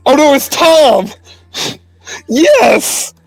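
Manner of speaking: high, loud delighted outburst; real excitement. Real, not acted. Player on hearing Tom is back, excited cry. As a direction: cheer a favourite character's return.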